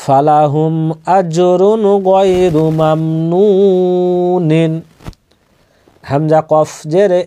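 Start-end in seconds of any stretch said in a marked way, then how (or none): 1.2-4.7 slow tajweed recitation of আজরুন গায়রু মামনুনিন, drawing out each syllable; slow Quranic recitation. Shown as a demonstration. Teacher demonstrating the verse to students, slow recitation. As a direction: chant আজরুন গায়রু মামনুনিন slowly with the nun held. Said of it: "এখানে দম ছাড়লে কি হতো?".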